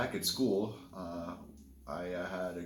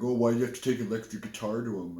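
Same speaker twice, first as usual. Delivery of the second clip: quick, louder mock whine of the hecklers; mocking bugger voice; 3 semitones higher, 7 dB louder, faster than usual. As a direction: sneer it fast like the kids who teased.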